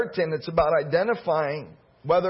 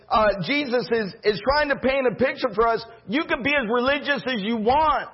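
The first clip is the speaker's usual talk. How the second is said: higher, fervent preaching build; 4 semitones higher than usual. Acted. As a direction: drive the point home to the church. Preaching climax on compassion.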